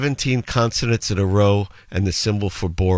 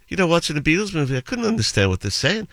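high-pitched, lilting Scouse; Scouse impression with swooping high pitch; sing-song Liverpool accent; 7 semitones higher, faster than usual. Shown as a demonstration. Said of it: "a bit of a sing-song".

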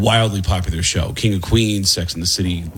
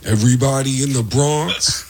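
Tracy Morgan voice, drawn out slowly; slow, lazy Bronx talk like Tracy Morgan; doing Tracy Morgan for laughs; Tracy Morgan impression; slower than usual. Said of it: "sounds like Tracy Morgan".

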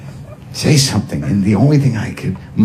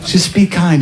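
pleading stranger; 10 semitones higher than usual. Retold or imitated. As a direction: plead for kindness. Neighbour pleading.

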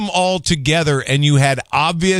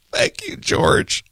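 laughing thanks; 3 dB quieter, slower than usual. Real, not acted.

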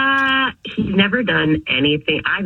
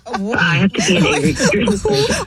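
laughing; 5 semitones higher than usual. Real, not acted. Caller reacts laughing.

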